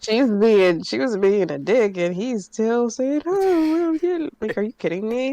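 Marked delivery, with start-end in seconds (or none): 3.3-4.3 high, meek shrug of a reply; mock meek reply. Mimicking a man shrugging off an insult, high and meek. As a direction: squeak it out like a pushover. Imitating a man's weak reply.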